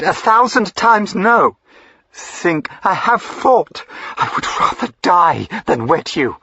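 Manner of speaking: high, loud, ringing refusal; defiant cry. Acted. Diana refuses the duke with a ringing defiant cry. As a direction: hurl the no at him.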